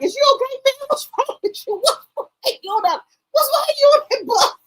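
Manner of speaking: put-on high, teasing kid voice, squeaky and cracking; mock the friend in a high squeal; imitating the friend. Son's friend taunting him on the bus, high mock voice. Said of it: "He was like".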